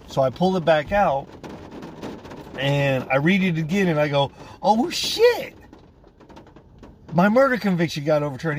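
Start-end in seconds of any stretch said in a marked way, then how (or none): 4.6-8.6 sudden high shriek of disbelief, then a fast rush; stunned exclaim. Retold or imitated. Prisoner rereading the letter, shrieking in disbelief. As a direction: burst out as the news finally lands. Realizing conviction overturned.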